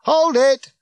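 loud, high-pitched command; full-voiced crowd-halting shout. A narrator's reading. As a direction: yell to stop everyone dead. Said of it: "he shouted again".

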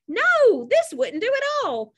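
high-pitched emphatic "no"; alarmed rejection. A narrator's reading. Wally rejecting his rehearsed bad news, dismayed. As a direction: reject the idea in dismay, voice shooting up.

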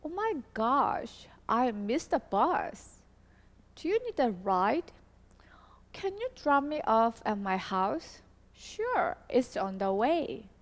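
slow, careful model reading; slow demo dialogue. Shown as a demonstration. Teacher rereading the dialogue for students, slow and clear. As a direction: read it again slowly so learners follow. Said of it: "자, 한번 다시 읽어보도록 하겠습니다".

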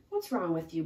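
usual speaking voice

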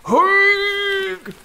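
very high strained grunt; straining under the weight; effort heave. Acted. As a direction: grunt as you hoist the heavy pack. Hoisting heavy pack.

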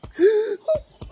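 high startled gasp; sharp gasp. Real, not acted. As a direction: gasp in sudden fright. Startled near fall climbing.

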